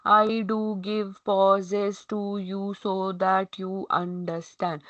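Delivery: very slow, dragging every word apart; badly paused reading. Shown as a demonstration. Teacher demonstrates an ineffective way of pausing, dragging slowly. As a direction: stretch it out with needless gaps. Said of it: "अगर मैं ऐसे बोलूँ".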